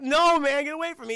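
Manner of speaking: high, loud mock yell; agitated refusal. Jumper warning the cop to back off, high and loud. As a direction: push them away with your voice.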